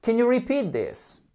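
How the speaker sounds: high-pitched, cheery model question; playful, high teacher voice; joyful. Shown as a demonstration. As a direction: lead a repeat drill in good humour. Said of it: "in a joyful atmosphere".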